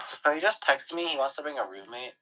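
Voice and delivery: ordinary talk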